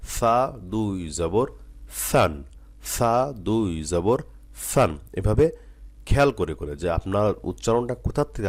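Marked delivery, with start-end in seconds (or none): 0.1-2.4 syllables stretched slowly with pauses between; unhurried, syllable by syllable, pausing before সান; slow recitation. Shown as a demonstration. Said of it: "আস্তে আস্তে উচ্চারণ". 2.8-5.0 সা দুই জবর সান drawn out slowly, each syllable apart; slowly and carefully. Shown as a demonstration. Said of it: "আস্তে আস্তে উচ্চারণ".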